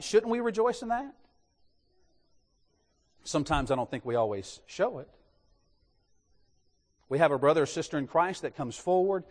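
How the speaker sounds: ordinary talk, nothing marked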